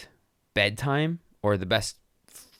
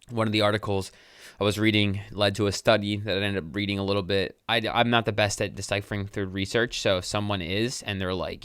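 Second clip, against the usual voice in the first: ordinary talk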